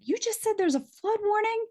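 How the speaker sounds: high mock-angry boss voice. Boss storming into the studio, mock-angry high voice. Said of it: "he's like".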